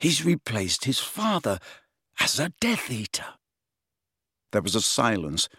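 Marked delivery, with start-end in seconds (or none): 0.0-3.4 measured realisation, softer towards the end; deliberate, hushed and higher-pitched; slowly. A narrator's reading. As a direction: piece it together phrase by phrase. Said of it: "said Harry slowly".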